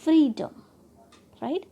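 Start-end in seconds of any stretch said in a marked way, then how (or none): 0.0-0.5 "freedom" with free high and loud; first-syllable stress. Shown as a demonstration. Teacher demonstrating the rule, free stressed in "freedom". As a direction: punch free, drop away on डम in "freedom". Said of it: "first syllable को trust किया है".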